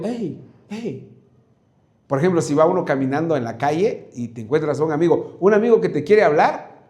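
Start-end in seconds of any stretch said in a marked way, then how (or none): 0.0-1.1 soft hushed hey, barely voiced; hushed call. Shown as a demonstration. Preacher contrasting a soft hey with a shout. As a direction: call out but hold it back. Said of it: "Pero si yo digo".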